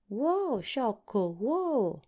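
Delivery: high-pitched calling after a runaway animal; shouting. A narrator's reading. Tip chases the foolish runaway horse, shouting high. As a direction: cry out urgently to make it stop. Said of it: "gridò Tip tirandosi su".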